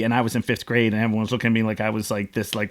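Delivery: ordinary talk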